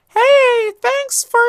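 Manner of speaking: chirpy high Elmo voice checking in; bright high-pitched Muppet voice; Elmo impression. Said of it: "That's not a good impression".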